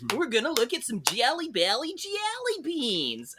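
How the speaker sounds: put-on high, bouncy kids-show host; high cheery tour guide for kids; enthusiastic tour guide voice. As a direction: pitch it way up like a Sesame Street character. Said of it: "he was using his voice where he's like".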